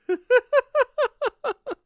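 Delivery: loud high laughing fit; real laugh bursting out at the joke. Real, not acted. Laughing at his own British impression, high and loud.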